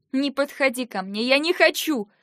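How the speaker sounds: high, loud panicked cry; frightened shout. Acted. Cornered wife screams at husband to stay back. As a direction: keep him away with a scream.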